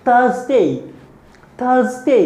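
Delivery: थर्सडे said high with a z sound; teacher drilling थर्सडे; high model word. Shown as a demonstration. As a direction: pitch थर्सडे up and stress the z. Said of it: "आप ये याद रखें".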